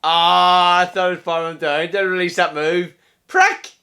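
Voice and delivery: loud, annoyed cry at the screen; frustrated outburst. Real, not acted. Player cursing his game loudly. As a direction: vent at the move that failed.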